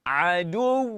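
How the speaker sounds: Adu with the doubled d pressed hard; hard stressed Adu. Shown as a demonstration. Teacher showing students the shadda in Adu, pressed hard. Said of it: "gitu baca dia".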